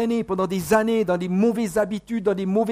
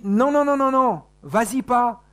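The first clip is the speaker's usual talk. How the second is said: raised, pleading no no no; high urgent warning voice; urgent inner warning; 5 semitones higher, 5 dB louder than usual. Retold or imitated. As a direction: plead like a conscience holding you back. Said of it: "quelque chose qui nous dit".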